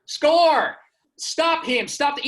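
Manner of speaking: high, loud coach yelling; a PE coach hollering at his players from the bench. Retold or imitated. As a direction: bellow empty orders from the sideline. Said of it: "He'd yell stuff anybody could yell".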